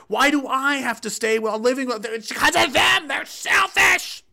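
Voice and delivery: building from indignant to high, strained mock shriek; hysterical mob. Mob demanding the unvaxxed be taken away, mock hysteria. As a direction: work up into a frothing hysterical whine. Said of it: "you can see it in their voice".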